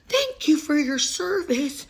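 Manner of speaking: high, drawn-out fawning thanks; sappy, over-the-top thank-you; mock gushing thanks. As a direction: gush like a starry-eyed fan. Mock gratitude.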